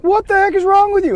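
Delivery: loud, high-pitched mock yell; exasperated shout, voice shooting way up; angry captain. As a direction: imitate a captain blowing up at a teammate. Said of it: "his anger went from at me to".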